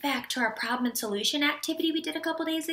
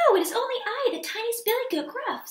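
high, tiny little-goat voice; very small voice; 7 semitones higher, faster than usual. A narrator's reading. Tiniest goat answering the troll, very small high voice. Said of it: "in his very small voice".

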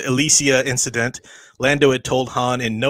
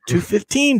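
mildly firm Two fifteen, settled and sure; said firmly; 11 semitones higher than usual. A narrator's reading. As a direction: state it as a fact, lightly firm. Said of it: "Dozer said firmly".

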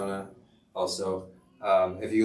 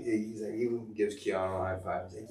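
ordinary delivery

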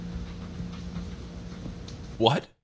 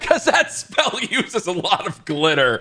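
slow, higher echo through laughter; laughing mock repeat; 4 semitones higher, slower than usual. Echoing an absurd excuse from the video, laughing mockery. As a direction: repeat it slowly, cracking up.

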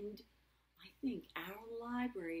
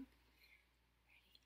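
usual speaking voice

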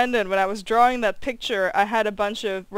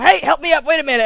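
louder, mock-helpless call for help; pleading James; 6 semitones higher, 9 dB louder, faster than usual. James on the floor begging Louis for help, loud mock plea. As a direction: beg to be pulled up off the floor. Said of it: "while James is going".

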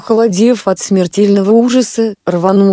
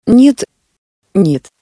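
horrified cry. A narrator's reading. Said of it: "В голосе Марка звучал ... ужас".

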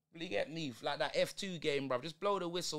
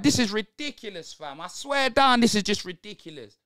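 loud, furious complaining at the team; angry rant; 2 semitones higher, 11 dB louder than usual. Real, not acted. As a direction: let it rip, loud and fed up. Ranting at his team's play.

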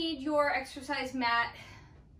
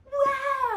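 surprised gasp; 13 semitones higher, 8 dB louder than usual. Real, not acted. Wind gust hits.

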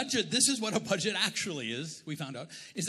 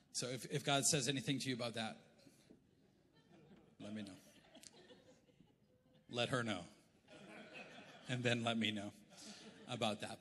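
laughing aside; 6 semitones lower, 10 dB quieter than usual. Real, not acted.

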